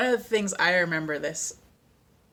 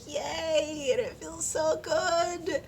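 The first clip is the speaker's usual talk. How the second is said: much higher, giddy cry of joy; joyful squeal; 8 semitones higher, much slower than usual. Retold or imitated. Worshipper lost in the song, high giddy squeal. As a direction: squeal like you're overwhelmed with happiness.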